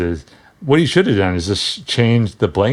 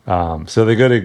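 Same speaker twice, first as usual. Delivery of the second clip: ordinary talk, nothing marked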